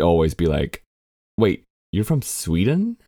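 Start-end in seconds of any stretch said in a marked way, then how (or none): 1.4-3.0 incredulous question, pitch climbing high at the end; mock astonished double-take; surprised disbelief. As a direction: act out someone taken aback, voice rising sharply. Acting out auditioners' surprised reaction.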